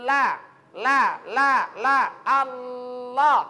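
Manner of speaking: loud La repeated, then Allah; lam drill. Shown as a demonstration. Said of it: "Tempel di langit-langit". (latihan lam keras lalu Allah)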